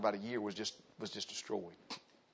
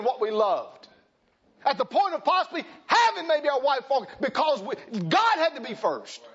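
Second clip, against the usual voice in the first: loud, higher hammering sermon voice; full-force preaching; 6 semitones higher, 6 dB louder than usual. Acted. Preacher exhorting the men of his church, loud and driving. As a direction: drive it home to the congregation.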